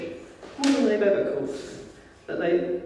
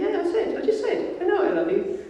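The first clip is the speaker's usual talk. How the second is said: much higher, exasperated squeak; squeaky exasperation. Retold or imitated. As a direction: rise into an annoyed squeak. [name] repeats answer, exasperated.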